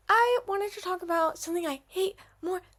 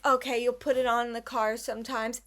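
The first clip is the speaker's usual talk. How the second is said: usual speaking voice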